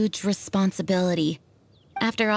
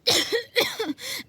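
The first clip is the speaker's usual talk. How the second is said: weak cough. Acted. Weakened Jean coughs after shouting.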